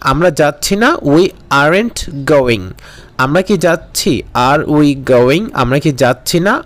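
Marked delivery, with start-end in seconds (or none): 1.1-2.6 very slow, word by word; slow model sentence. Shown as a demonstration. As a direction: spell it out for the class. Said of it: "model sentence drill". (খুব ধীরে, প্রতিটি শব্দ আলাদা করে)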